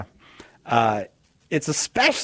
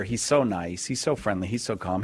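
usual speaking voice

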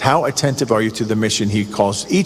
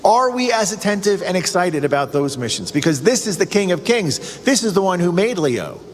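ordinary delivery

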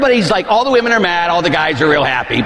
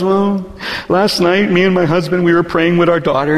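ordinary talk, nothing marked

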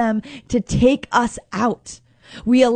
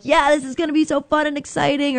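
higher, quick pretend eagerness; mock excitement; 4 semitones higher, faster than usual. Preacher imagining Jesus gushing about his ordeal, mock excited. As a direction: put on a rushed, over-keen voice. Mocks fake eagerness for suffering.

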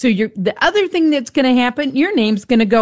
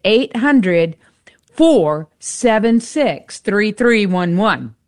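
slow, spaced-out phone number; nice and slow; slower than usual. Real, not acted. Radio host asks listeners to call the pledge line, slowly. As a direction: read the number out slowly, with pauses between groups. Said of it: "I'm gonna say it nice and slow".

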